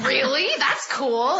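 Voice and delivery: light up with eager interest; excited reaction. Retold or imitated. Guest re-enacting hearing about Funimation, excited.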